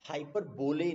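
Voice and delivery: हाइपरबोले as HY-per-BO-lay; spelling pronunciation. Shown as a demonstration. Teacher showing what not to say, हाइपरबोले as HY-per-BO-lay. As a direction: read हाइपरबोले as spelt, long o. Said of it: "नहीं बोलते इसको".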